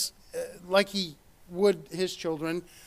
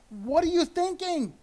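exasperated rebuke; 9 semitones higher than usual. Acted. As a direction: chide them like a fed-up parent. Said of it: "talking to the Israelites".

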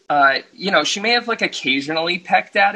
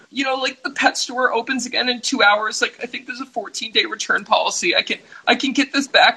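lightly mocked mom, voice pitched higher; higher-pitched mom bargaining through tears, lightly played; imitating sobbing mom; 8 semitones higher than usual. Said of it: "My mom is sobbing, and she goes".

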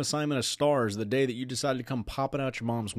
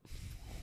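quiet laugh; 17 dB quieter than usual. Real, not acted. Breaks up reading study.

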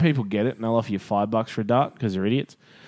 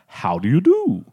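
a hint of a posh English accent; lightly posh British "How do you do"; mock English; slower than usual.

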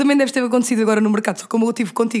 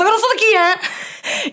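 higher, proud Caldas exclamation; Caldas accent; 9 semitones higher, slower than usual. Retold or imitated. Local from Caldas getting worked up in Lisbon, Caldas accent. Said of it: "quando começa a enervar em Lisboa".

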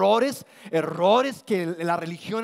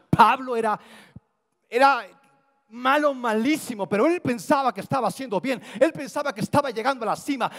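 más agudo y fuerte, con fuerza de púlpito; predicación intensa; 5 semitones higher than usual. Real, not acted. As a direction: eleva la voz para recalcar la maldad de Pablo. Predicación en crescendo. (predicando con fuerza, voz alta)

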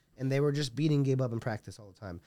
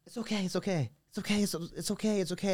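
put-on higher, gentle child's reassurance; little girl comforting; 5 semitones higher, 3 dB quieter than usual. Little girl comforting a teary coach, gently higher childlike voice. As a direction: soothe him like a small girl would. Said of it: "she's like".

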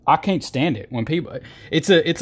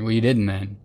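ordinary delivery

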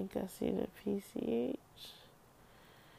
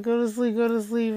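loud, higher insistent coaxing; urging inner voice; 4 semitones higher, 10 dB louder than usual. Retold or imitated. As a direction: push it like a voice nagging you to sleep. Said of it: "yeah, I feel like".

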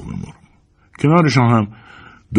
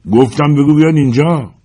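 loud, higher barked command; shouted order; 9 semitones higher, 5 dB louder than usual. Acted. Gunman Al yells at George to fetch the cook, loud and high. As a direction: shout the order again, losing patience. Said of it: "آل داد زد".

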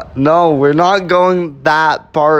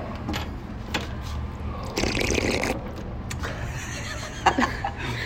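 long breathy rushing hiss; waterfall roar; 13 dB quieter than usual. Acted. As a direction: whoosh like falling water. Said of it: "[name]'s impression of a waterfall".

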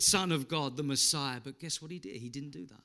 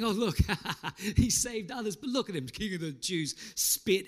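jeering taunt, higher pitch; mocking; 5 semitones higher than usual. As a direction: sneer at him like the religious leaders. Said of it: "they started to mock him by saying".

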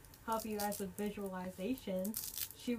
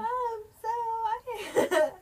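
shrieking up high; high squeal; 13 semitones higher, 10 dB louder, much slower than usual. Real, not acted. Squeal after harmonizing joke.

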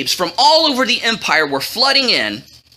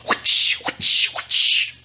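sharp voiceless whooshing whip cracks; whip-crack noises; much slower than usual. A narrator's reading. Onlookers mock him with whip-cracking noises. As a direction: imitate a whip with your mouth. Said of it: "make all these whip cracking noises".

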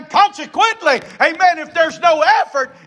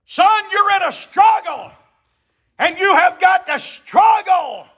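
high, forceful shouted charge; fatherly exhortation; 6 semitones higher than usual. Acted. Paul telling his son Timothy to compete, shouted exhortation. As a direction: press it on him like a command. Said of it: "Paul is telling him to compete".

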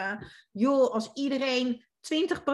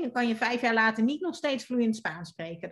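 usual speaking voice

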